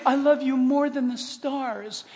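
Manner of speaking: slow, soft declaration of love; acted tenderness. Speaking love into voice command, tender mock voice. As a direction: draw it out softly and lovingly. Example of loving words to phone.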